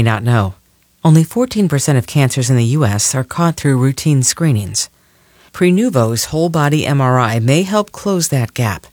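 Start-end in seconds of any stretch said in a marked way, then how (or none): none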